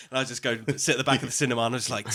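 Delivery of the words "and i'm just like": ordinary talk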